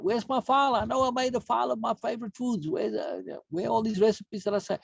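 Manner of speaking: high, puzzled voice hunting for a lost file; confused searching. Retold or imitated.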